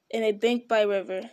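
ordinary delivery, nothing marked

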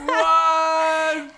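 high laughing outburst; surprised laughing shout. Real, not acted. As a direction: burst out in disbelief, laughing. Reacción al chiste del celular. (grito de sorpresa entre risas)

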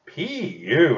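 pinched nose, nasal disgust; holding nose. A narrator's reading. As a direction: say it with nose held shut. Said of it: "holding her nose".

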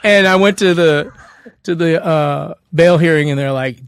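ordinary talk, nothing marked

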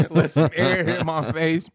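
words broken up by laughter; laughing through it. Real, not acted. Laughing while recalling the drunk drive home.

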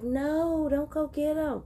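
put-on high scared kid pleading; scared little niece. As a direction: beg like a terrified little girl.

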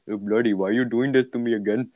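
put-on light Indian accent; mock Indian accent, lightly done. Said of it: "In my Indian accent".

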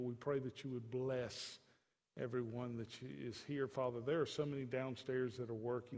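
quiet closing prayer voice. Real, not acted. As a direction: pray earnestly over the congregation.

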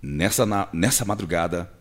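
low, solemn radio newsreader; grave news report. Shown as a demonstration. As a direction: read the tragic news low and serious. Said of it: "Um acidente".

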